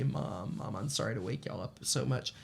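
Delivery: soft retold middle-of-the-night call; hushed apology. Retold phone call waking mom at night.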